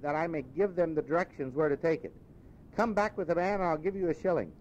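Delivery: high, eager, then slowing warmly; an old man suddenly beaming at a stranger; delighted Scrooge. Acted. Scrooge asking the boy the day, delighted.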